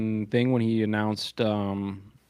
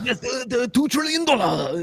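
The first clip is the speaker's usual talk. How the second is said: mock Japanese accent; 14 semitones higher than usual. Mimics the Japanese investor again.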